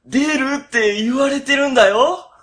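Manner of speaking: high, loud spooked warning; fearful warning. Acted. Boss warning his junior the room is haunted, spooked. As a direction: urgently insist it's haunted.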